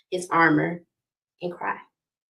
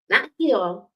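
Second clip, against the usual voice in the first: usual speaking voice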